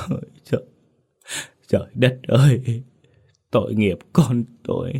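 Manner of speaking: breathy then high, crying cry to heaven; tearful lament. Acted. Mother begging the teller, crying out to heaven. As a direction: let the grief break through the words.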